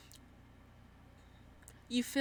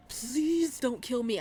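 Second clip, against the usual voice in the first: mock pleading; 3 semitones higher, 7 dB louder, slower than usual. Begging after profanity joke.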